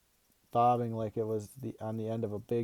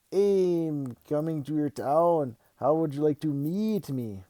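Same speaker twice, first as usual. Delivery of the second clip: slow, higher, hammed-up Mexican accent; bad Mexican accent; 5 semitones higher, 4 dB louder, slower than usual. A narrator's reading. Dad playing the gecko for his kid, in a bad Mexican accent. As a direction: drawl it in a terrible Mexican accent. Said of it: "worst Mexican accent ever".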